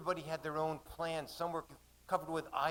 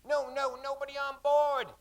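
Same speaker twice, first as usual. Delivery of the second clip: high loud shout; 12 semitones higher, 5 dB louder than usual. Acted. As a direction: push them back with your voice. Mate pushing people back.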